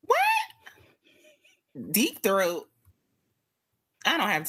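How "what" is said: shocked what. Real, not acted. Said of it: "shocked at song title".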